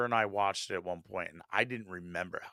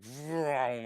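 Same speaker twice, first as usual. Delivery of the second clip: imitated whoosh of something zooming past; drawn-out vroom noise; 4 dB quieter than usual. Showing the star's brief appearance, imitated zooming noise. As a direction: stretch out a zooming engine noise. Imitates a shooting star going by.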